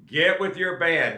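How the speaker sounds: ordinary talk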